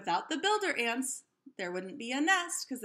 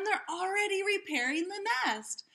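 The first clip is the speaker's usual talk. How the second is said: excited; 8 semitones higher than usual. A narrator's reading. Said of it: "yelled Tim".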